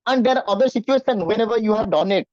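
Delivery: ordinary talk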